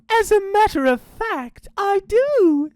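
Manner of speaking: high, singsong falsetto; fake mama. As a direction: tease them in a sugary high mama voice. Dingo poses as mama.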